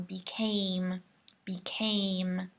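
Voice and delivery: became with a long, strong A; became, A held long. Shown as a demonstration. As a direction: stretch the A in became. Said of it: "make sure that A is really strong and long".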